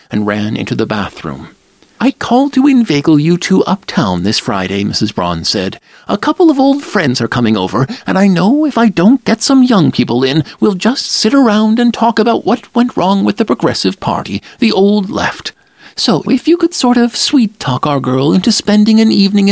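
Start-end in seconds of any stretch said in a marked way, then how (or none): 2.0-4.8 higher, chatty mother's invitation; Mrs Braun's voice. Acted. 6.1-19.5 higher, chatty mother's invitation; Mrs Braun's voice. Acted.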